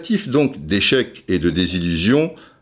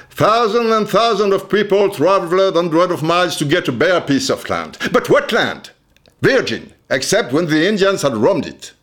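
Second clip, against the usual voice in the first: reciting memorized text; 7 semitones higher than usual. Shown as a demonstration. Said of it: "je vais vous donner immédiatement".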